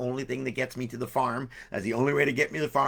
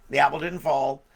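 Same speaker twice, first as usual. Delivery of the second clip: ordinary delivery, nothing marked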